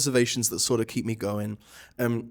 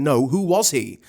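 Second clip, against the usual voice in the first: louder, rude demand, hand on hip; 3 semitones higher, 7 dB louder than usual. Shown as a demonstration. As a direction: push hard for the name, rudely. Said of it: "Some days she's really rude".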